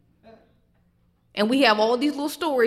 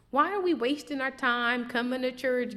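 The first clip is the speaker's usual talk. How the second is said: ordinary delivery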